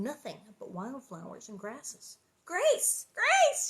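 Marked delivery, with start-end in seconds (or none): 2.4-3.7 high, loud scream of the name. A narrator's reading. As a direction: scream for the lost child. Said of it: "she screamed".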